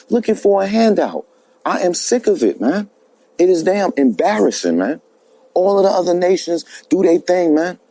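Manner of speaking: usual speaking voice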